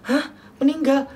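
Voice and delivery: disbelieving son hears his mother died; stunned disbelief. Retold or imitated. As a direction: replay the shock of not believing it. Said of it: "Nggak percaya gitu loh".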